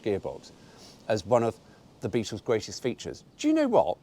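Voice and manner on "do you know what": much higher, louder question; eager aside. Real, not acted. Returning to the brochure claim, eagerly asking listener.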